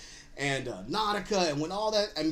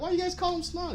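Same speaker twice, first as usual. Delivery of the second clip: curious asker; 12 semitones higher than usual. Retold or imitated. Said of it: "they're like".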